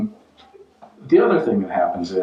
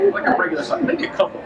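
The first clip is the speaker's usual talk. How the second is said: mock hungry; faster than usual. Imagined self choosing pancakes.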